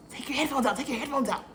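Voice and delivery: nagging, repeated command in a put-on voice; bossy boss imitation. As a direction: imitate a pushy boss demanding attention. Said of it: "that's how he talked to me".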